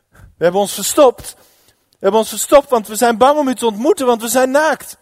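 loud, high, panicky excuse; frightened confession. Acted. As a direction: blurt it out fearfully to God. Bange mens antwoordt God. (luid en paniekerig bekennen)

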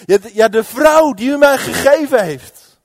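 loud, high, flustered protest; defensive blaming. Acted. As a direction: stammer and shift the blame loudly. Man schuift schuld af. (luid verontwaardigd de schuld afschuiven)

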